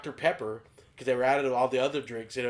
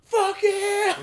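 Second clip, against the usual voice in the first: much higher, loud triumphant yell; excited whoop; 7 dB louder than usual. Retold or imitated. Driving off with free chicken, whooping in triumph. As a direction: cheer like you just won big.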